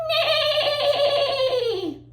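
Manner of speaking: high, long trembling whinny; whinny high and let it fall at the end; horse neigh. Shown as a demonstration. Teacher playing the horse sound for children, high whinny. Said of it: "the horse makes a".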